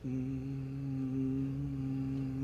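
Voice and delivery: quiet hum to loosen the voice; soft humming warm-up. Shown as a demonstration. Singing teacher warming up her cords with a hum. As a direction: hum softly, just warming up. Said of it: "un buen rato".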